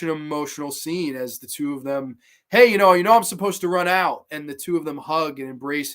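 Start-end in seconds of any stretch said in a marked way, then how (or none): 2.5-4.2 retold calling out. Acts out film dialogue.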